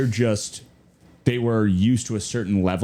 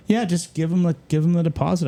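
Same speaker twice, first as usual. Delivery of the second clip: lightly voiced client, higher and easygoing; mock client; 8 semitones higher than usual. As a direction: toss off the client's shrug, pitched up. Client voiced in retold story.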